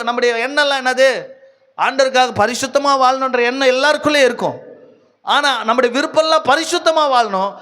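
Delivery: high, impassioned sermon delivery; full-force preaching. Real, not acted. Preacher warning the congregation the devil rises like a flood, impassioned. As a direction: preach it urgently, pitched well up.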